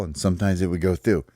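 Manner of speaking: ordinary delivery, nothing marked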